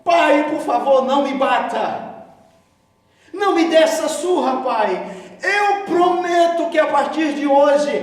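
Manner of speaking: high, loud desperate begging; pleading child. Retold or imitated. Kneeling boy begging his father for mercy, loud desperate plea. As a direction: beg the father not to hit you. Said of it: "pedi perdão".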